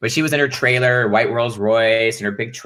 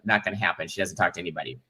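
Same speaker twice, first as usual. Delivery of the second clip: hushed flat no; 4 semitones lower, 9 dB quieter, faster than usual. Retold or imitated. Voicing the director flatly.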